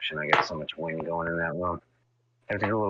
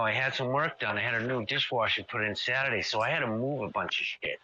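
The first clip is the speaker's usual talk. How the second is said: ordinary talk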